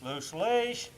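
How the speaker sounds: normal talking voice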